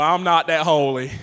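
ordinary talk

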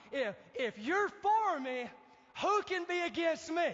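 bold challenge, building to a high shout; confident. Acted. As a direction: declare it fearlessly, stretching the first half. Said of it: "There's the confidence".

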